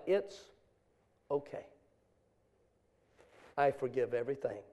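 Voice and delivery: soft, low, halting letter reading; hushed forgiveness. Retold or imitated. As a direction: say it quietly, with heavy pauses. Retells tearful forgiveness letter.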